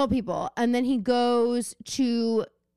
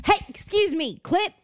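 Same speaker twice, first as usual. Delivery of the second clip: higher-pitched pretend parent reprimand; mock scolding parent; 7 semitones higher than usual. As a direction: call a naughty child to attention, playfully stern. Acted parent scolding child.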